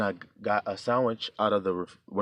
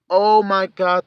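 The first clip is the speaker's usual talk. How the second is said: exclaiming; 11 semitones higher, 10 dB louder, much slower than usual. Retold or imitated. Caffeine hits too hard.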